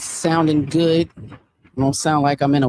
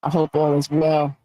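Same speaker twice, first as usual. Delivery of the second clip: normal talking voice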